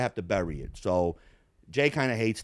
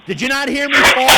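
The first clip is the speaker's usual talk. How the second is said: high, loud demand; indignant shout; 12 semitones higher, 11 dB louder than usual. Retold or imitated. Imitating her as she comes in, high, loud and indignant. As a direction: yell it at the room. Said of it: "you hear her say".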